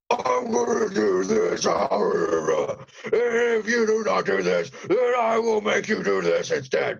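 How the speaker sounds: high, slow, half-crazed menace; unhinged villain voice. Retold or imitated. Host mocking the actor's first over-the-top take. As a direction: imitate a villain losing his mind. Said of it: "It sounded like Piccolo on Dragon Ball Z if he were going insane".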